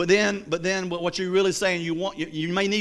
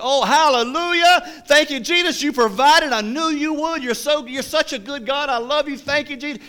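high, loud hallelujah outburst; overjoyed thanks to God, acted out big; imitated jubilant praise; 8 semitones higher, 5 dB louder than usual. Woman finding groceries on her porch, jubilant high praise.

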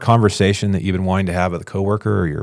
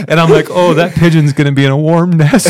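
higher pitched and louder, giggling through it; laughing; 8 semitones higher, 6 dB louder than usual. Retold or imitated. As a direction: laugh while saying it. Laughing at a silly thought.

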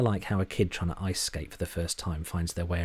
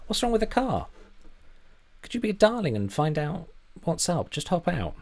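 much higher, tight and forced polite; strained high voice; 11 semitones higher, 4 dB louder than usual. A narrator's reading. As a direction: squeeze the words out high and tense. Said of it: "Charlie's voice was strained high".

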